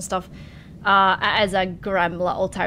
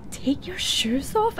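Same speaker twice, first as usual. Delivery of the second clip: incredulous; 9 semitones higher, 4 dB quieter, slower than usual. Acted. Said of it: "you're like".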